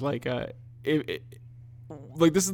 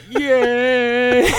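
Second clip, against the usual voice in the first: mock cheer; 7 semitones higher, 8 dB louder than usual. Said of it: "But my dad just".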